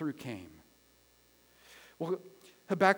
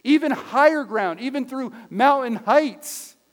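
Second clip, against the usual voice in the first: loud, driving pulpit delivery; impassioned preaching; 5 semitones higher, 13 dB louder than usual. Real, not acted. Pastor explaining deer feet to the church, preaching forcefully. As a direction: build to a forceful, shouted declaration.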